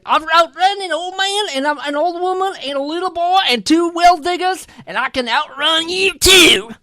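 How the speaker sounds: high, fast put-on English accent; high-pitched English-accented braggart. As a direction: rattle off a boast in mock English. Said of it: "this is England, okay?".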